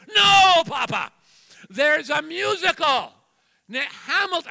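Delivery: mock exasperated granddaughter shout. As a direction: correct grandpa in disbelief. Granddaughter corrects him exasperated.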